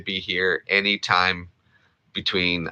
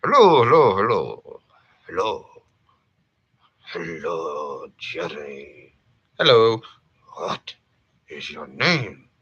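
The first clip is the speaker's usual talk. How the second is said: Thurston Howell greetings. Shown as a demonstration. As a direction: greet grandly like a millionaire.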